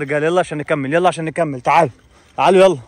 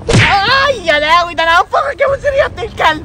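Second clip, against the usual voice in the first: shrill mother voice; 5 semitones higher than usual. Acted. Mother is hit and threatens.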